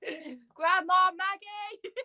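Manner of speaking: drawn-out laughing cry of the name; laughing exclaim. Real, not acted. Laughing at her friend's prompt, drawn-out laughing exclaim. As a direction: stretch the name out through a laugh.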